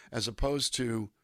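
usual speaking voice

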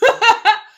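a sudden loud high cackle; big burst of laughter. Real, not acted. Preacher laughing at how bad her hospital week was, loud burst.

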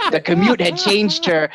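ordinary talk

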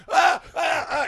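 high shrieking ahs, loud and sudden; a dad yelps as the baby bites him, high pained shriek; imitated scream of pain. Dad bitten by baby, high loud yelping scream. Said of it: "and he's screaming".

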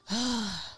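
stressed waking-up sigh, acted; imitated exhausted sigh; weary sigh. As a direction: let out a soft, tired exhale. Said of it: "I used to wake up stressed".

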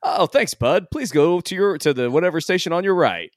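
robotic automated voice. Retold or imitated. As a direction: sound like a cheerful recorded message. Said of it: "a robotic, like, a-, automated voice".

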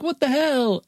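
high startled cry of a rattled heroine. Retold or imitated. Heroine jolted in a bouncing helicopter, high startled cry. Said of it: "Scarlett Johansson's like".

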